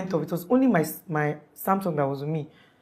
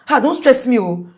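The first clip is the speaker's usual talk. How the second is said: higher-pitched, irritated mock complaint; retold irritation; 6 semitones higher, 4 dB louder than usual. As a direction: act out a fed-up husband brushing off his wife. Retelling husband's irritated outburst to wife.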